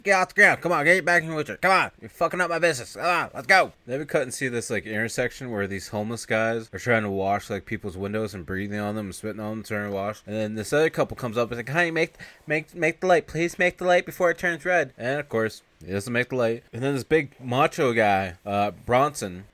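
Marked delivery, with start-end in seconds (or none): 0.0-3.7 loud, high mock scolding; retold angry yelling. Shop owner yelling at a fallen customer, mock angry shout. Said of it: "the owner is yelling at the guy". 11.7-14.9 mock urgent plea. Couple urgently pleads at traffic light.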